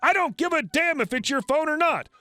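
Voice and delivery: angry ordering. A narrator's reading. Crazy lady orders valet to park her car, angry and bossy. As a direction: lay down the law, indignant. Furious customer cuts valet off.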